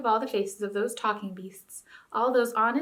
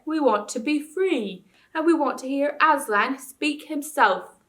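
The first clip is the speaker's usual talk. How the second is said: put-on old bear voice; 5 semitones higher, 5 dB louder, slower than usual. A narrator's reading.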